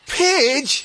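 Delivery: high exclamation without real volume; mild startled cry of a name; startled shout back. A narrator's reading. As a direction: exclaim it, pitch jumping up. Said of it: "he shouted back".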